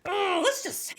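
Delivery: high, stalling, hammed-up squirming; evasive embarrassment. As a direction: dodge the question with comic awkwardness. Dodging question about her own job.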